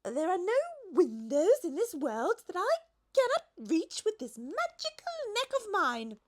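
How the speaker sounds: high, smug and sure; superior. A narrator's reading. The giraffe boasts to the Duke with a superior smile. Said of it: "superior smile".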